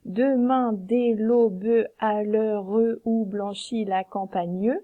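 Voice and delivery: slow and unsure, "l'aube" said "lo-beu" and "l'heure" said "leu-reu"; awkward hiatus reading; hesitant, E pronounced. Shown as a demonstration. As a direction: read it haltingly with every E sounded. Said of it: "si on le relit en étant moins assuré".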